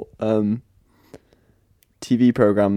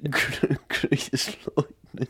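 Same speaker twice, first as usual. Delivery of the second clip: laughing; 10 dB quieter, much slower than usual. Pretend. Laughing through a Grease line.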